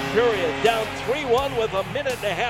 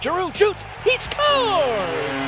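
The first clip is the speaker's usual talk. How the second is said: excited goal call; 7 semitones higher, slower than usual. Real, not acted.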